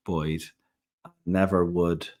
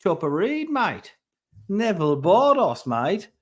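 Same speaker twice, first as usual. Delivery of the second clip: much higher, hammed-up Australian Chopper Read accent, drawn-out "mate"; mock Aussie crime-figure voice, loud and stretched; Australian accent impression; 12 semitones higher, 7 dB louder than usual. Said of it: "what that accent is".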